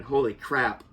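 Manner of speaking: fighting back tears over the death scene; getting emotional. Real, not acted. Reviewer recalling Logan's death, voice dropping, near tears. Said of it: "I'm about to get emotional again".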